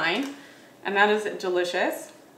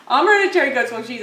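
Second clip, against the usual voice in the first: fast and a bit louder, sure now; quick correction; 3 semitones higher, 6 dB louder, faster than usual. Real, not acted. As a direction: rattle off the name cleanly. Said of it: "Wait, I'm sorry, my brain's spacing".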